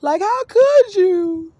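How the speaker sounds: high, slow, loud wail; anguished cry. Retold or imitated. Speaker on their knees crying out to Larissa, anguished. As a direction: cry out in drawn-out reproach. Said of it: "I fell to my knees saying".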